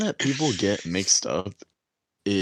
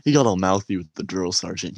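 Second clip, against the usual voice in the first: ordinary talk, nothing marked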